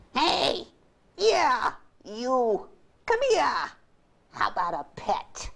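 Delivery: high loud calls, then asking; calling out. Acted. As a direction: shout him over, then wheedle. Dog calls out to Toby.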